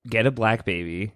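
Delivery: ordinary delivery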